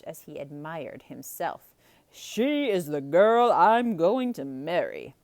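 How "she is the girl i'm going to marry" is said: a hint of a boastful Gaston; loud, proud declaration; declaring. A narrator's reading. Gaston announcing to himself, loudly declaring. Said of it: "he declared".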